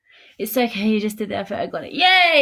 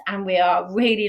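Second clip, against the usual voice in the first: usual speaking voice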